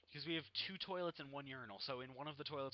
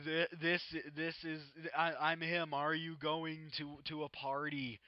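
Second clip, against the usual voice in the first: hammed-up prank caller; 7 dB louder, slower than usual. Friend continues prank call.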